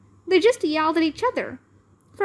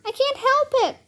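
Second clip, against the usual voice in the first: put-on very high little-girl voice; hammed-up, high-pitched helplessness; squeaky helpless cry; 12 semitones higher than usual. A narrator's reading. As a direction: squeal it as if losing control. Ivy succumbing, in squeaky voice.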